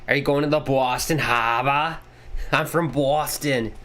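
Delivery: put-on playful Boston accent with dropped r's. Streamer jokes about being from Boston, in a Boston accent. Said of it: "only thing I can say in a Boston accent".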